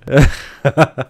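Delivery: short, loud real laugh; burst of laughter. Real, not acted. Streamer amused by the goat-noise mod, bursting out laughing.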